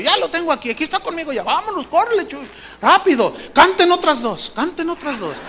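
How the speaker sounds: hurried mock orders into a radio; acted frantic radio call. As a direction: imitate a harried organizer rushing everyone. Organizer on the radio, rushing.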